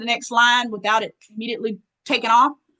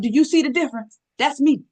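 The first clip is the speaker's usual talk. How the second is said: ordinary delivery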